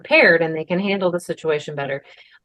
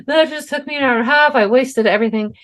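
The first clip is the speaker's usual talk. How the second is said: imitating old panicky self; 7 semitones higher, faster than usual. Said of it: "I would have been crying".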